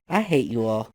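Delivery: ordinary delivery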